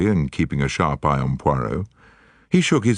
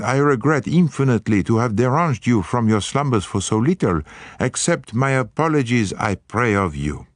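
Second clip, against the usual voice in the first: put-on higher, courtly French-accented apology; bow out with exaggerated Gallic regret; Belgian-accented Poirot; 9 semitones higher than usual. Poirot apologizing to the guests, courtly Belgian accent.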